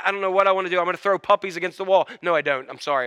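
normal talking voice

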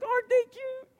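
put-on cooing little boy. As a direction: coo like a small child over bunnies. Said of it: "He's like".

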